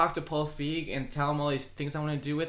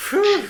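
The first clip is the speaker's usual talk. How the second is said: a big loud high whew of relief; loud relieved whew; 11 dB louder than usual. Real, not acted. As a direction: blow out a loud high whew, glad it's over. Relief after awkward topic.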